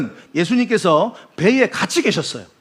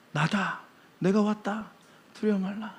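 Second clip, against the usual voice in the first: gentle reassurance; 12 dB quieter than usual. Acted. Jesus softly telling frightened disciples not to fear. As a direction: soothe frightened friends.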